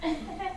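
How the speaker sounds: short, high mock gasp; quick intake of breath, mimicking surprise; imitated shocked gasp. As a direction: gasp as if taken aback, acting it out. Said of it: "He went like this".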